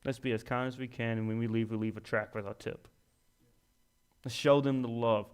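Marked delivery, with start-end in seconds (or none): 0.0-2.9 soft, gentle, reassuring; kind suggestion. Retold or imitated. Diner quietly urging friends to tip the waiter well, soft and kind. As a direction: calmly nudge toward kindness. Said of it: "are you gonna say".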